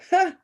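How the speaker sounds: sharp high loud fake laugh; mock laugh. Narrator laughing at her own joke to the woman, one sharp ha. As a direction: bark out a single ha. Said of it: "She doesn't laugh".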